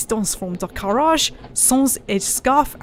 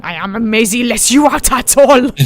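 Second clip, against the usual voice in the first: louder, heated retort; hot retort; 8 dB louder than usual. Acted. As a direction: snap it back hotly. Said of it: "I retorted hotly".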